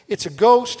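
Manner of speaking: loud, high cry of alarm. Acted. Terrified disciples seeing him walk on the sea, crying out. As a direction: blurt it out at a sudden apparition. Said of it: "they cried out".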